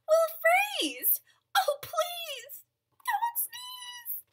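very high little fish voice; high, pleading squeal, drawn out at the end; squeaky fish plea. Acted. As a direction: climb into a tiny high-pitched plea. Frightened fish beg elephant not to sneeze.

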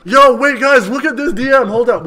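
high, loud sudden alert; excited. Real, not acted. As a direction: grab the chat's attention. Spots a DM.

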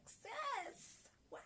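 high, awed gasp of a question; amazed. Retold or imitated. Reacting to Nyanzi's fearless speech, amazed and high. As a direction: squeal it in disbelief. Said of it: "I was like".

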